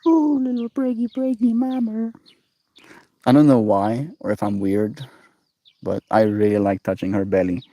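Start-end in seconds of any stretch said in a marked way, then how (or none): 0.1-2.1 much higher cooing pet voice. Real, not acted. Talking to pregnant cat.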